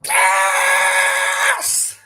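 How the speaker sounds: long, very high victory scream; real full-throated celebration; ecstatic scream. Real, not acted. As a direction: hold a loud triumphant yell. Celebrating the news at full volume.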